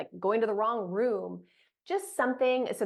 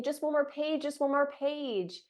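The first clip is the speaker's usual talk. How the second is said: put-on pleading child voice; 6 semitones higher than usual. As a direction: wheedle like a stalling kid. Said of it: "she'd say".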